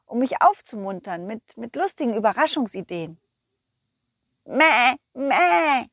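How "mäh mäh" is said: sheep bleat. Acted.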